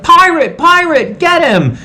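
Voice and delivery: high mock panic, pointing out the pirate; a kid squeaking in alarm, half-joking; mock-frantic high yelp of pirate, pirate; panicked cry. Jim panicking, voiced high.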